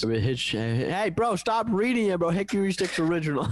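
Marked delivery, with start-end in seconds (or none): 1.1-3.5 raised, teasing protest; exasperated. Real, not acted. Friend reads the chip names wrong.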